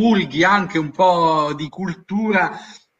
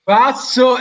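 game show call; 5 semitones higher, 5 dB louder than usual. Acted. Host calling a pass.

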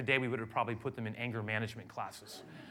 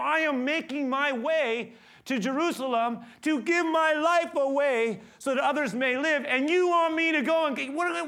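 loud, higher, climbing sermon voice; full-force preaching; 10 semitones higher, 10 dB louder than usual. Acted. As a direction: preach it out with rising fire.

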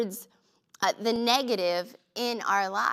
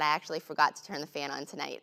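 lower, quick, matter-of-fact; offhand husband; 6 semitones lower, 4 dB quieter, faster than usual. Retold or imitated. Husband explaining the fan, low and casual. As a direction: toss it off like it's nothing, low and fast. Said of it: "He said".

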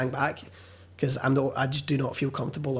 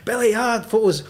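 higher, louder call across the room; 7 semitones higher, 4 dB louder than usual. Retold or imitated. Airline boss calls Billy away for photos, raised and higher. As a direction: hail him over for photos.

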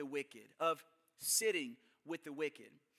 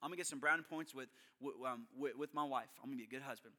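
quick self-talk; 5 semitones lower, 8 dB quieter, faster than usual. Retold or imitated. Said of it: "I was like".

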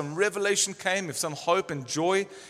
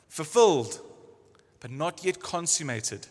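ordinary talk, nothing marked

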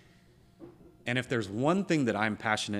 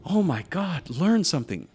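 louder, higher oh my God; exasperated shout; 5 semitones higher, 7 dB louder than usual. Real, not acted. Urging listeners to learn something, exasperated. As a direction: throw it out in exasperation.

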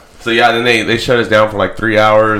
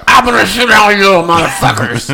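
higher, louder laughing mock threat; drunk mock threat; 10 semitones higher, 5 dB louder than usual. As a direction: yell it like a drunk kid chaser, laughing.

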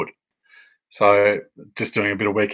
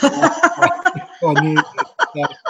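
laughing; 3 dB louder, much slower than usual. Real, not acted. Laughing at the coincidence.

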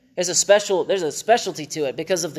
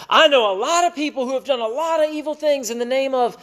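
mock the protesters in a raised, pitched-up voice; imitating people who run from the topic; mimicked objectors; 9 semitones higher than usual.

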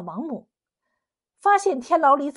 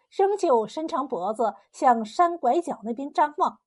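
ordinary delivery

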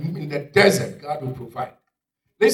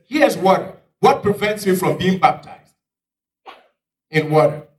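loud, high-pitched excitement; raised, urgent plea; eager eunuch; 5 semitones higher, 8 dB louder than usual. Acted. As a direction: blurt it out, thrilled at the discovery.

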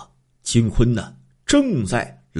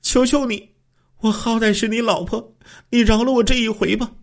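high, pleading voice of a kneeling wife; a woman begging her husband for mercy, high and tearful; 12 semitones higher than usual. A narrator's reading. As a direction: beg him in a high, tearful voice. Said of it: "抱住他的双腿哭诉说".

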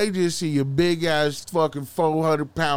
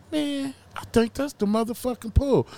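musing aloud; 8 semitones higher than usual. Retold or imitated. Driver spotting the stolen pool, musing in a high voice. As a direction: say it high, as a dawning suspicion. Acts out his thought while driving.

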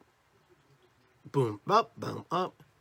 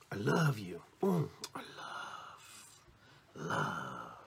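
drawn-out, sinking softer and lower; emotional I love you; 5 semitones lower, 5 dB quieter, much slower than usual. Shown as a demonstration. Speaker showing emotion behind the words, lingering and low. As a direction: savour "love", letting it drop away. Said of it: "Emotion behind the words are just as powerful".

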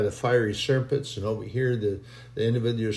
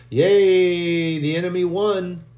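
mock cheer; 5 semitones higher, 5 dB louder, slower than usual.

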